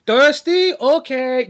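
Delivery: high, loud put-on offer; mock helpful offer. Landlord offers drink while dousing the dumpster, mock cheerful. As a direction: pipe it up with fake courtesy.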